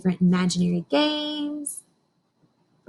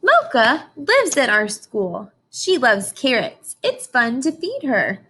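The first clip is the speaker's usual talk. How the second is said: slow, bright storybook read-aloud; reading to children; 4 dB louder, slower than usual. Acted. Teacher reading a picture book to her class, slow storybook voice. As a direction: read each line out slowly for the class.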